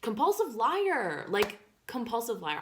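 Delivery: loud, high, drawn-out outburst; exclaimed accusation. Real, not acted. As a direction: call out the liar, loud and high. Exclaims at the friend's lie.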